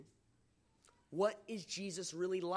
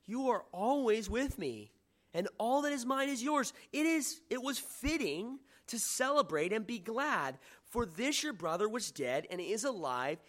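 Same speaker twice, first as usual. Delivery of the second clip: pleading father; 5 semitones higher, 4 dB louder than usual. Acted. Father's reply read aloud.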